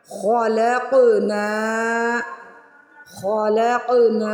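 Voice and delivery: slow recitation-teacher model of خلقنا; qalqalah demo. Shown as a demonstration. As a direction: stretch خلقنا and shake the qaf. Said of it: "قاف کو ہلانا ہے".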